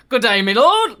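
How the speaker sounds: replaying the old man's loud British hail; a hearty British country accent; British greeting. Host savouring the old man's British hail. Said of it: "It's like".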